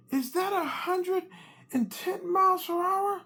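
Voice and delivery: very high, slow alarmed question; alarmed wife. Retold or imitated. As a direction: stretch it out in disbelief. Wife reads the speedometer.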